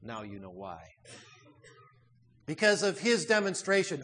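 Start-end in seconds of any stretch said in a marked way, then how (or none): 0.0-0.8 quietly. Retold or imitated. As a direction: say it quietly, with weight. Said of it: "replied quietly".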